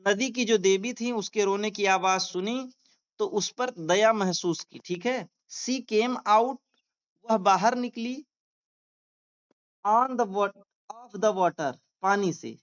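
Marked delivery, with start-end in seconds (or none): 5.5-6.6 very slow, word by word dictation; slow classroom reading aloud. Shown as a demonstration. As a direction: read it out slowly for students to follow. Teacher dictating slowly.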